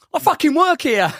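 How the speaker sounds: mock-offended high protest; indignant retort. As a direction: throw it back at him, put out. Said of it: "She goes".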